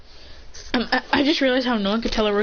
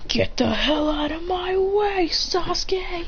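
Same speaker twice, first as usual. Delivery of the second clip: high, stretched-out strained yell; angry mock yell; 8 semitones higher, slower than usual. Acted yell while kicking.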